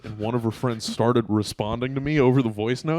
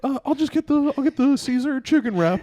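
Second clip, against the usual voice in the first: high-pitched imitation of a girlfriend; high mock female voice; girlfriend's voice; 13 semitones higher, 3 dB louder than usual. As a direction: pitch way up as a hesitant woman ordering. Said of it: "She's like".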